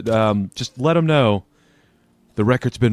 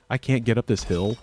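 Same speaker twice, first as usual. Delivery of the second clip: ordinary talk, nothing marked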